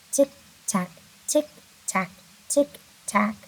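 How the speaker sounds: crisp clock-like tick, tack. Shown as a demonstration. Teacher ticking like a clock for the class. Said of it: "T sound in the beginning".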